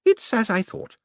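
a verdict with relish; old lady, satisfied. A narrator's reading. Old lady judging Moist, high and satisfied. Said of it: "she said".

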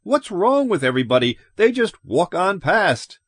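high, agitated protest; worked-up and incredulous. A narrator's reading. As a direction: rush it in rising disbelief. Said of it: "His voice rose excitedly".

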